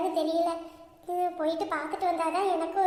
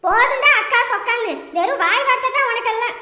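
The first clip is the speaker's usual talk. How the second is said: louder, cutting him short; angry cut-off; 4 semitones higher, 7 dB louder than usual. Acted. Sister silencing her brother, loud and angry. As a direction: shut him up hard.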